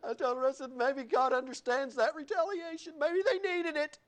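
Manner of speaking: laughing through the retelling. Real, not acted. Pastor recalling a funny story, laughing. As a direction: crack up while telling it. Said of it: "I started laughing so hard".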